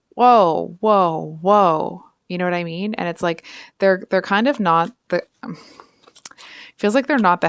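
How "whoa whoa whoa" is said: mock-startled protest, louder at first; taken aback. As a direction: call a halt to someone overreacting. Mimes objecting to an overreaction.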